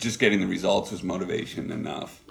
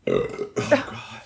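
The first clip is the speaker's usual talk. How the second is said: words pushed out through a burp; deep, belched-out words; 13 semitones lower than usual. Real, not acted. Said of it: "pardon me, I'm gonna burp".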